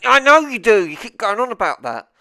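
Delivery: loud, high fed-up retort; friend's loud exasperated reply. Retold or imitated. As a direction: snap back like a tired friend. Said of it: "Mark Furtado said".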